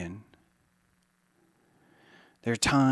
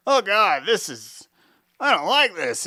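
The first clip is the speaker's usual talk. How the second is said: a higher, louder flinch of discomfort; pained whine; 6 semitones higher, 4 dB louder than usual. Acted. The listener shrinking from harsh light, mock pained whine. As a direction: recoil from the glare, whining. Eyes burning without glasses.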